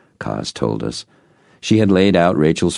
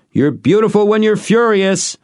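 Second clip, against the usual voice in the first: high-pitched shout across a distance; loud, high, playful holler; 5 dB louder than usual. A narrator's reading. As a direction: call it out loudly toward the barn. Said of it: "he shouted in the direction of the barn".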